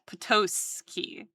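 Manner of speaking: Potowski drawn out slow; careful sound-out of Potowski; testing Potowski slowly. Shown as a demonstration. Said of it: "Like that?".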